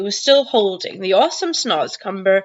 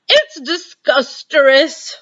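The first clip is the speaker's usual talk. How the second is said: slow, high, louder gurgle through a full mouth; gurgling; 7 semitones higher, 5 dB louder, slower than usual. Acted. Giant with a mouthful of snozzcumber, gurgling. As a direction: gargle the word out in disgust. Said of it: "the B F G gurgled".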